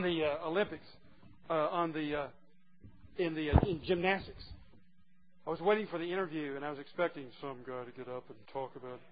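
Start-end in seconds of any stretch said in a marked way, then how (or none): none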